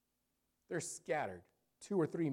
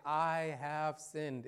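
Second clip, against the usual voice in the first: slow confession; much slower than usual. Acted. Voicing a sinner's confession.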